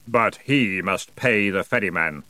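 slow, dark ferryman voice; drawn-out, weighty objection; accusing. A narrator's reading. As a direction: accuse slowly as the hooded boatman. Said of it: "he said accusingly".

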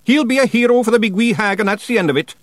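high, loud, sharp Scots voice; sharp retort. A narrator's reading. Rob overruling Big Yan about the hero, sharp Scots retort. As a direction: shut the argument down sharply, pitched high. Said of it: "said Rob sharply".

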